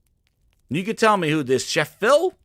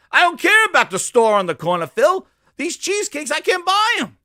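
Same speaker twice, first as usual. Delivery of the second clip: much higher and louder, a mock customer losing patience; exasperated yell; 13 semitones higher, 9 dB louder than usual. Customer yelling at Chef Phil over missing cheesecakes, exasperated shout.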